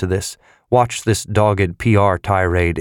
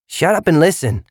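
sharp, loud and higher; 9 semitones higher than usual. A narrator's reading. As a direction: snap it out loudly. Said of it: "the curly-haired youth said loudly".

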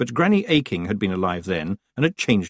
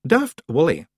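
raised, firm, icily patient reprimand; icy patience; 7 semitones higher, 3 dB louder than usual. A narrator's reading. Rob calling out the one noisy Feegle, icy patience. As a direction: address him with strained patience. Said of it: "with icy patience".

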